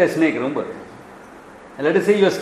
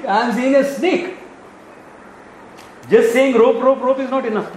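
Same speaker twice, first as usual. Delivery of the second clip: much higher, pleading and insistent; agitated protest; 8 semitones higher, 3 dB louder than usual. Acted. Frightened walker protests to his friend, high and agitated. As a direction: refuse to be talked out of it.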